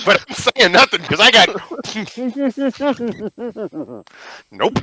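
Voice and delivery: talking round a cookie; words muffled by a mouthful; mouth full. Pretend. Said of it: "I've shoved a cookie in my mouth".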